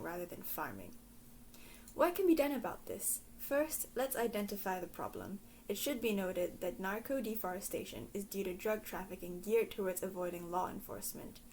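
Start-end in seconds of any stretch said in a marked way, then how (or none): none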